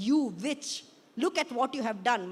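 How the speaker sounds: mild scream. A narrator's reading. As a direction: cry out accusingly, short of a full scream. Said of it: "my mother screams".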